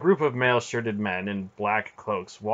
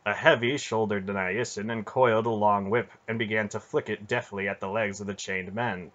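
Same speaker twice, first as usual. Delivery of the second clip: usual speaking voice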